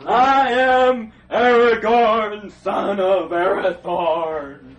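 very loud, slow, grand proclamation; solemn Aragorn. Acted. Aragorn revealing himself to the hobbits, in a booming slow proclamation. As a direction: announce your name like a king revealed. Grand self-introduction, dun dun after.

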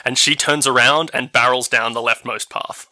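ordinary delivery

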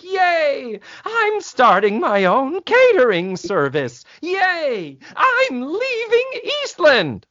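high, squealing yay, hammed up; mock girlish cheering. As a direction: cheer like giddy schoolgirls, teasing. Girls cheering Mrs Garrett's news.

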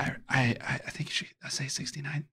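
very soft, half-whispered hint; feed her the answer very softly; hushed prompt. Real, not acted. Secretly prompting another player's answer.

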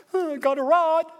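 high, loud realization; surprised Moses. Acted. Moses noticing his rod, high and loud. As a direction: discover it in your hand. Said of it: "What do you have".

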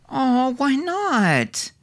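put-on high, slow child's plea; a small boy begging his dad not to go; pleading little boy. A narrator's reading. Little Milo begging his father, high and slow. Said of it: "pleaded Milo".

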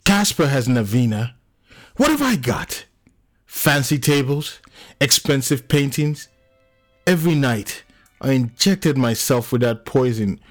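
high-pitched, bitter tirade; anguished outburst. Acted. John lists what he has without her, anguished. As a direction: pour it out, peaking high on the question.

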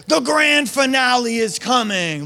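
aggressive. Retold or imitated. Preacher mocking an aggressive God, grand and high. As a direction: proclaim it like a showman. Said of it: "all of those are really aggressive".

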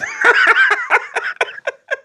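high burst of laughter; loud laugh. Real, not acted. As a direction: crack up laughing.

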